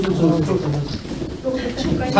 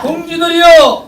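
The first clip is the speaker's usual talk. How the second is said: rally leader shouting, pitched up; roaring rallying speech; 6 semitones higher than usual. Real, not acted. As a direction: call out to comrades. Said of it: "자, 제가 하겠습니다". (우렁찬 선창)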